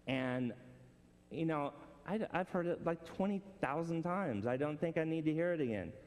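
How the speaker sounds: ordinary talk, nothing marked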